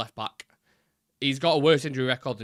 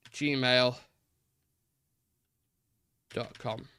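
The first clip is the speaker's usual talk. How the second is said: usual speaking voice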